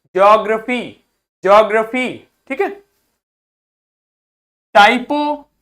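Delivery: ordinary talk, nothing marked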